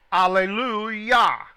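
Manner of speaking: "Alleluia" stretched slowly and called out loud; drawn-out Alleluia. Shown as a demonstration. Teacher shows the class how to say Alleluia, drawn out and loud. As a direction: sing out "Alleluia" long and full, like praise. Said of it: "As in".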